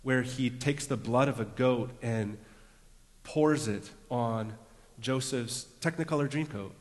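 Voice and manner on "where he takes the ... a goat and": usual speaking voice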